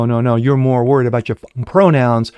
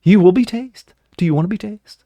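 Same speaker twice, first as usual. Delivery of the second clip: higher, taunting threat of the taser; mock officer threat; 9 semitones higher than usual. Host mimics the officer threatening to tase the airman, higher and taunting. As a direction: sneer the warning like a bullying cop. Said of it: "he goes".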